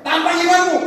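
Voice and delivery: loud, high-pitched preaching shout; forceful exhortation. Real, not acted. Preacher challenging the congregation's faith, forceful exhortation. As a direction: fire out the challenge to them.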